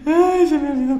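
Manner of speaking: loud, high-pitched wail of dismay; dismayed realisation. Real, not acted. Streamer realising he forgot something, loud high wail. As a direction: cry out at your own slip, high and loud.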